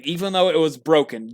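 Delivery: ordinary talk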